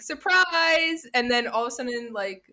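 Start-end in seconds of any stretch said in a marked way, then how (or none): 0.0-1.1 mock surprise. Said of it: "Feyre's like".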